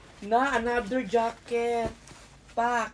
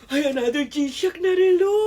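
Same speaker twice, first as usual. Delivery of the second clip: loud, higher-pitched excited exclamation; thrilled surprise; 4 semitones higher, 10 dB louder than usual. Real, not acted. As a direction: cry out at another great gift. Discovers another gifted watch. (tuwang-tuwa at gulat)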